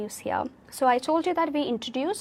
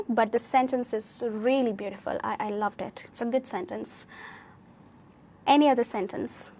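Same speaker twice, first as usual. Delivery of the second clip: ordinary talk, nothing marked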